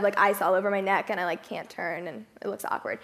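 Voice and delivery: usual speaking voice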